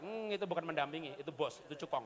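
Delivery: ordinary talk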